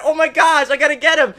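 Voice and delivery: high, loud, urgent cry; frantic excitement. Retold or imitated. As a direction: yell it like the catch might escape.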